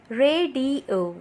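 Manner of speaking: RA, DI, O as three separate beats; syllable by syllable. Shown as a demonstration. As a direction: split Radio in three for clapping. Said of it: "Clap with me as I say these words".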